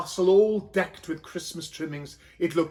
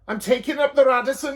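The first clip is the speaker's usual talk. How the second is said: high, loud and quick; hurried donkey man; 8 semitones higher, 7 dB louder, faster than usual. A narrator's reading. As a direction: call back over his shoulder. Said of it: "he said".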